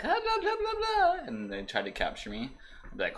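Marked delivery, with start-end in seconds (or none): 0.1-1.2 very high squeaky gibberish voice; high mock girly chatter. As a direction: squeak out fake chatter like the soldiers. Imitating women soldiers' chatter.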